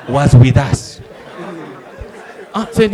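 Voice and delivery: ordinary talk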